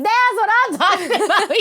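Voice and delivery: high, loud and slow, laughing through it; excited cheer. Retold or imitated. Cheering dad's reply.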